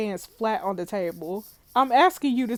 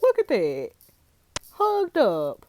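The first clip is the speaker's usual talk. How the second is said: mock outrage; 2 semitones higher, 3 dB louder than usual. Real, not acted. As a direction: call it out like juicy gossip. Teasing at the photo.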